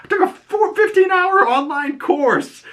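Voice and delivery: mock panicked squeak. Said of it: "It's like".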